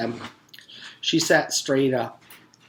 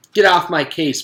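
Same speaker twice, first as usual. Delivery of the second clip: rude retort; 6 semitones higher, 9 dB louder than usual. Acted.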